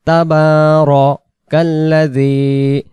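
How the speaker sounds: তাবারাকাল্লাযী with the বা stretched one alif; long ba drawn. Shown as a demonstration. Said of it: "এক আলিফ খাড়া জবর". (বা এক আলিফ টেনে)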